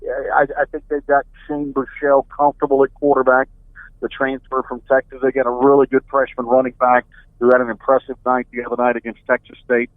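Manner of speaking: ordinary delivery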